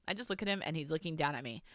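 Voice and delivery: ordinary delivery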